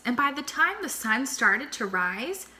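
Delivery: ordinary delivery, nothing marked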